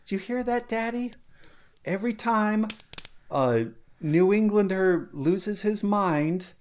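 put-on very high little girl voice; little Zuzu. Little Zuzu telling Daddy about a bell ringing. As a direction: chirp it like a small child. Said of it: "little Zuzu says".

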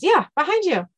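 high, loud little mouse voice. Acted. Second mouse backing the first, high-voiced. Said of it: "The second mouse says".

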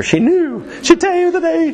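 high, squealed playful hype. Acted. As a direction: pitch jumps way up, hyping her up. Playfully hyping up her quick answer.

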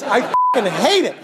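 usual speaking voice

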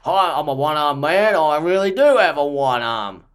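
lightly put-on Aussie accent with a laugh; a hint of Aussie bloke introducing himself; Aussie yobber. Auditioning actor playing a one-armed Aussie, mild Aussie accent. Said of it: "I'm an Aussie yobber".

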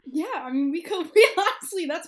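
laughing, high and loud. Real, not acted. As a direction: laugh through the words. Laughing at popcorn podcast joke.

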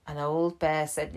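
ordinary delivery

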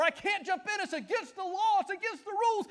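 a hint of a whiny tag partner pleading; rule follower. A partner stuck in the corner pleading, mildly whiny. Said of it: "rule follower".

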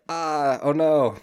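lightly acted "oh no"; mock dismay. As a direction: cry out in playful, retold dismay. Everybody's retold mock dismay.

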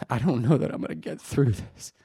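holding back real emotion; softly, struggling to get through it; choked up. Real, not acted. Preacher doubting he'll get through this, real choked-up emotion. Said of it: "revert to humor to hide my emotions".

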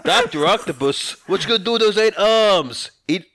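put-on loud, high-pitched New York accent; New York Spider-Man. Spider-Man confronting the villains, loud New York accent. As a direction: shout out like a hammy New York cartoon hero. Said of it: "me doing an audition for Spider-Man".

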